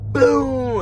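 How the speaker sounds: high, falling boom; imitated thunder boom. Mimicking the dramatic noise while going down, high falling boom. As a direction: voice a thunderclap sliding downward. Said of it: "a thunder".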